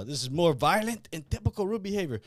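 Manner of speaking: put-on Russian accent, second try. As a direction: retake the stiff Russian-accented line. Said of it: "what does he say?".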